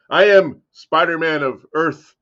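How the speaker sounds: slow, loud hero proclamation; heroic declaration. Acted. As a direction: announce yourself like a superhero. Grand hero self-introduction.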